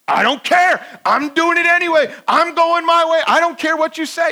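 put-on high, loud, defiant child's retort; rebellious kid yelling back. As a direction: fling it back like a stubborn kid.

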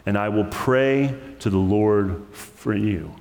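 measured, unhurried Bible reading with pauses; scripture reading. Acted. Pastor reads First Samuel to his church, measured and reverent. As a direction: read the passage aloud to the congregation.

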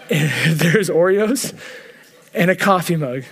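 quoting through real laughter; can't hold back the laugh. Real, not acted. Laughing while quoting wife's prayer.

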